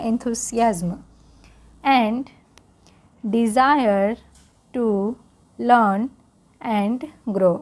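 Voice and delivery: slow, careful read-aloud for learners; English read-aloud for Hindi-speaking students, slow. Shown as a demonstration. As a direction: read it slowly so learners can follow along.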